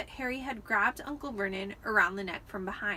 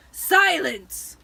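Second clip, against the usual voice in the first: furious bellow, much louder; yell; 6 semitones higher, 13 dB louder than usual. A narrator's reading. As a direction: roar to shut the boy up. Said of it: "yelled Uncle Vernon".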